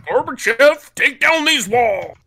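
loud, high, slow presidential proclamation; Ronald Reagan impression. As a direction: boom it out like a speech at the wall. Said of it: "pretend you're Ronald Reagan and say".

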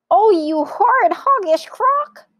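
very high, loud monkey squeal; outraged monkey cry. A narrator's reading. Monkey condemning the crocodile, outraged squeal. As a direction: shriek the insult indignantly. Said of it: "cried Mugglewump".

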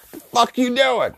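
high, crackly grandma challenge; old lady voice. Retold or imitated. Grandma catches grandson sneaking the cream, crackly old lady voice. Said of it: "fucking old lady voices, just, like, loud and fucking crackly".

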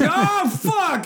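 yelping oh fuck, laughing; surprised and laughing; startled curse. Retold or imitated. Acted picnic wind mishap.

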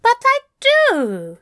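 insisting. Acted. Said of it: "Aziraphale insisted".

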